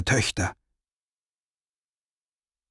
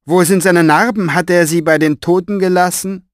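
high, loud, pressed sneer; villain voice; 7 semitones higher, 7 dB louder than usual. Acted. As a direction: shout it down with contempt. Villain taunts from battlements.

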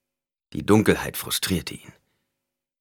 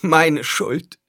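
high, louder moan; groaned self-blame; 10 semitones higher, 6 dB louder than usual. A narrator's reading. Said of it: "ächzte Alia". (hoch geächzte Klage)

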